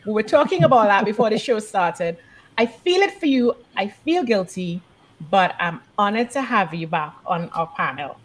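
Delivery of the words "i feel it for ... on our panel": normal talking voice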